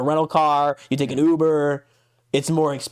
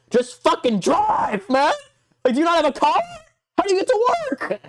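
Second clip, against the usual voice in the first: incredulous rant. Real, not acted. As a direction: voice climbing high in outrage. Ranting about coworker's travel costs.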